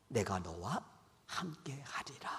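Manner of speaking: soft whispered promise, low and calm; hushed divine promise. Retold or imitated. God whispers his promise to believers in hardship. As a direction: whisper it as God's reassurance. God's voice to the faithful.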